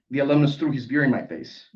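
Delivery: ordinary delivery, nothing marked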